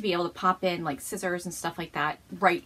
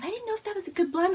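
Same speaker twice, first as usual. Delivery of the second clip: quoting friend; 11 semitones higher, faster than usual. Retold or imitated. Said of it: "She goes".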